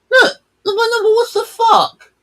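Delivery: very high, loud disbelief; outraged shouting. Real, not acted. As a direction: shriek in outrage at the replay. Outraged watching the crash replay.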